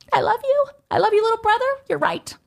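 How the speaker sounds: high, squeaky little voice; touched reply. Retold or imitated. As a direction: go small and high, touched by the encouragement. Tearful reply to brother's encouragement.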